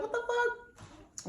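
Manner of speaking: high, slow, drawn-out disbelief; a high, stretched what the fuck; stunned disbelief. Retold or imitated. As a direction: drag out the shock as you realise. Waking to find him gone.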